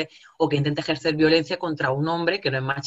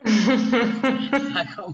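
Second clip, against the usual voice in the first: laughing; 7 semitones higher, 3 dB louder than usual. Real, not acted. Laughing at romantic song idea.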